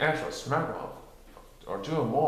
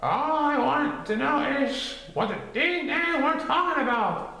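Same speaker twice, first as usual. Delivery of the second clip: high folksy Gopher; 14 semitones higher than usual. A narrator's reading. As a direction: demand it like a testy gopher.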